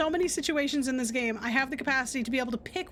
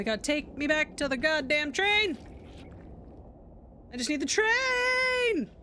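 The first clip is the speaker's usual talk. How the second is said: exasperated wail; 2 semitones higher, slower than usual. Real, not acted. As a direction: wail it out in real frustration. Frustrated gamer wails at game.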